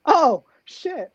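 the penny drops all at once; sudden realization. Retold or imitated. Sudden realization in retold thought.

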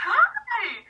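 high, loud imitation of a cracking greeting. Celebrity greeting a bright-faced fan, imitated voice crack. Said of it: "with the voice crack and everything".

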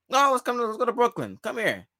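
high-pitched imitation of a player coaxing; mock coaxing. Player calls a teammate over to Brooklyn, mock coaxing. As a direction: start high and insistent, imitating a recruiter.

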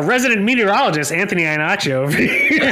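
laughing. Real, not acted. Teasing a co-host as the weatherman.

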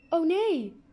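high, loud cry of dismay. A narrator's reading. Girl realising the wrong film is on, crying out. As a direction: shout it in sudden alarm. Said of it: "riep ik".

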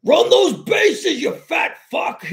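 high, loud, slow stadium bellow; drunk fan yelling. Retold or imitated. Mets fan in a hat yelling at the runners, a loud high bellow. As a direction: holler at the players like a rowdy fan. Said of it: "he, like, yelled".

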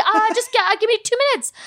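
high-pitched, falsely agreeable panic; mock terrified. Actresses asked for an accent on the spot, mock terrified. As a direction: imitate horrified actresses pretending they're fine. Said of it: "horrified, terrified, but they're like".

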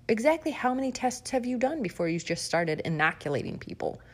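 ordinary delivery

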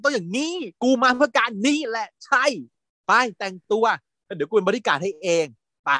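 high, eager sidekick voice; excited friend. Retold or imitated. Best friend egging Fierce on, excited and high. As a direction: cheer her on like a pumped-up best friend. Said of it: "ไอ้เพื่อนก็เลย".

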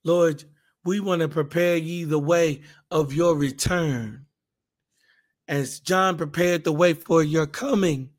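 ordinary delivery

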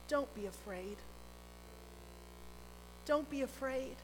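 soft, quiet reassurance; calm a frightened heart softly; gentle reassurance. Acted. Jesus reassuring in the storm.

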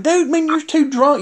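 high-pitched retold telling-off; exasperated scolding. Wrestler telling his drunk colleague off, exasperated. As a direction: pitch it up, fed up with him.

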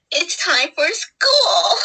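high, stretched-out sing-out; odd drawn-out announcement. Real, not acted. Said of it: "why did I say it like that".

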